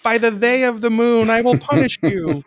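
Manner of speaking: high, loud Sailor Moon battle cry; bright magical girl. Pretend. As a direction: declare it like a magical girl hero. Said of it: "Sailor Moon magical girl transformation".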